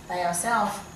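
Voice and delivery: ordinary talk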